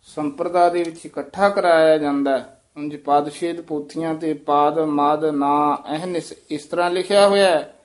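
ordinary talk

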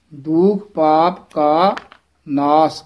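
very slow ਦੁਖ ਪਾਪ ਕਾ, pause, then ਨਾਸ; pause before naas. Shown as a demonstration. Teacher shows the break between ਕਾ and ਨਾਸ. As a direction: hold after ਕਾ, then say ਨਾਸ. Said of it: "ਕਾ ਦਾ ਸੰਬੰਧ ਨਾਸ ਨਾਲ ਨਹੀਂ ਕਰਨਾ ਹੈ".

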